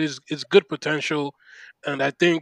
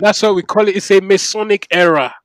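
louder, higher, emphatic proclamation; excited; 5 semitones higher, 10 dB louder than usual. Real, not acted. Fan naming his team's new era to the podcast host, excited. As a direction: proudly declare it with rising excitement. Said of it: "you sound very excited".

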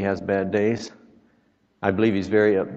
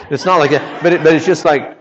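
louder and higher, preaching with fire; rising fervour; 6 semitones higher, 10 dB louder than usual. Real, not acted. Preacher stirring the congregation, louder and higher. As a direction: swell up into the point.

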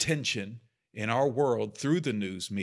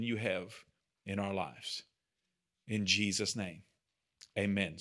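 prayer; 3 semitones lower than usual. Real, not acted. Leads the group in prayer.

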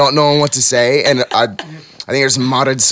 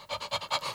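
sharp gasp; 19 dB quieter than usual. Retold or imitated. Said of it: "I started freaking out".

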